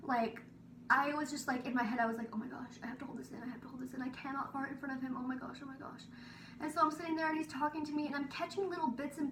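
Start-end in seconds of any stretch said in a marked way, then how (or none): 2.3-6.0 soft, fast, frantic muttering; panicked inner voice. Retold or imitated. Girl panicking silently beside the boy, hushed and hurried. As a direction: rush it under your breath in panic.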